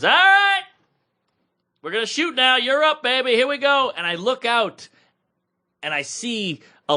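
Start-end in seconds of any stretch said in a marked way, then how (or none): 0.0-3.9 high, louder call to start the show; imitating the director. Director calling the comic to the stage, mock hype. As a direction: pump the comic up like a hyped director. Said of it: "the director goes".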